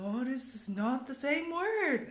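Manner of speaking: shocked realisation imitated. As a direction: let the realisation land, slow and loud. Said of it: "And I go".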